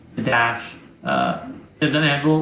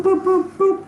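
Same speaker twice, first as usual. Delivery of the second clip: beeping sound effect. Acted. GM voices computer pings.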